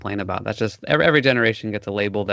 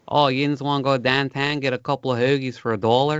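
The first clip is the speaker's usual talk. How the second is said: put-on Pittsburgh accent; 3 semitones higher than usual. Host showing off how people talk in Pittsburgh, Pittsburgh accent. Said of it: "the Pittsburgh accent".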